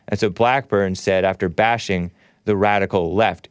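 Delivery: usual speaking voice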